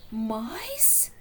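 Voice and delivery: unvoiced curious rising whisper; intrigued whisper. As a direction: breathe it with sudden interest.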